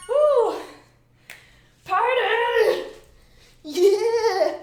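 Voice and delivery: loud, high cheering; short excited high shouts; party whoop. Real, not acted. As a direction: whoop it up on the dance floor. Party cheering while dancing.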